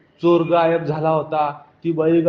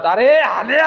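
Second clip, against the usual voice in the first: calling out; 8 semitones higher, 4 dB louder than usual. Acted. Chasing the buffalo, calling out.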